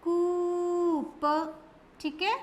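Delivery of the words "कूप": कूप said very slowly, oo stretched; very slow teaching word; drawn-out oo. Shown as a demonstration. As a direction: draw out the long oo in कूप. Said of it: "बड़े ऊ की मात्रा लगा के शब्द बनाया".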